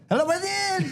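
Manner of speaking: imitating the French guy on the phone, high; French accent. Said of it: "Like, he's really French".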